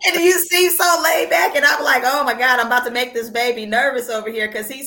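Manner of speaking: excited gushing. Real, not acted. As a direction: gush at him.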